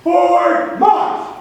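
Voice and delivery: high, slow drawn-out command shout; drill command. Real, not acted. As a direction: stretch out march like a drill sergeant.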